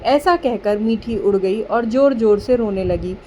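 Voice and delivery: usual speaking voice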